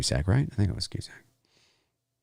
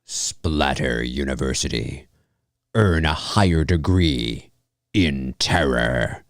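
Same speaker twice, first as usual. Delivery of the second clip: slow low trailer narration; 6 semitones lower, slower than usual. Shown as a demonstration. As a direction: announce it like a movie trailer. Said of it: "That's the kind of voice I'd do".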